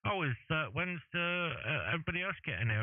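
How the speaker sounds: high-pitched caricature asking where everyone is; mock high voice. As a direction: ask it like a naive guy in a high voice. Character voiced in retold show.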